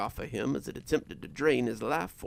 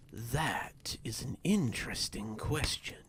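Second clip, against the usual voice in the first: whispery, menacing figure; creepy half-whispered relish; sinister stranger; 4 dB quieter than usual. Acted.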